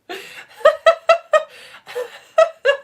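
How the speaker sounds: loud, very high laughing fit; long real belly laugh, near tears; squealing fit of giggles; helpless laughter. Real, not acted. Cracking up at balloon head.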